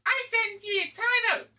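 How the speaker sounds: very high, squeaky put-on pronouncement; mock judge's sentence. Showing how Rita would go, high squeaky judge. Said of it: "that's actually how it would go".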